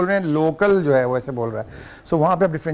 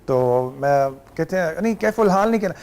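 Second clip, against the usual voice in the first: ordinary delivery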